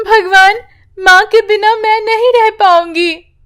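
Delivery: high, with a sob on the first word; a girl weeping to God; crying child. Acted. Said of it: "यूं कहकर रोने लगती है". (रोती हुई बच्ची की ऊँची पुकार)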